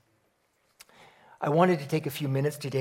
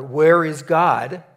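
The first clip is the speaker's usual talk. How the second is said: much louder and slow, a stern pastor pressing the question; deeper, louder pastor voice; 2 semitones higher, 11 dB louder, much slower than usual. Retold or imitated. Pastor presses the silent boy, loud and slow. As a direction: lean in and boom it out slowly. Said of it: "in a little bit deeper voice ... says a little bit louder".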